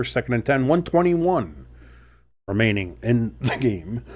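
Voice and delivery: ordinary talk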